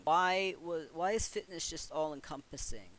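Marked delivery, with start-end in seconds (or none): none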